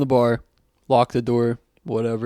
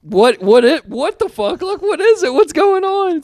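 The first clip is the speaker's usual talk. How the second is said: high, loud confused demand; much higher and louder, rising in pitch; imagined yell; 7 dB louder than usual. Retold or imitated. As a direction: yell it as if confronting him. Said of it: "to be like".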